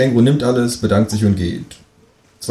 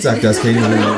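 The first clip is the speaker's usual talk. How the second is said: ordinary delivery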